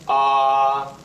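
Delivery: long flat "ah"; first tone. Shown as a demonstration. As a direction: sustain "ah" on one steady note. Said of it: "the first tone".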